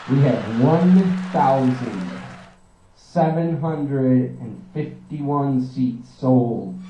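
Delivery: drawn out, deliberate number reading; slow emphatic count; slow announcement. Acted. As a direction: spell it out for effect. Announcing ticket sales slowly.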